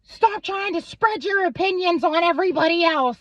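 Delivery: high, loud mocking whine of the angry boyfriend; high, loud mock scream of the angry ex-boyfriend; mocking his yell. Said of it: "he'd start screaming at me".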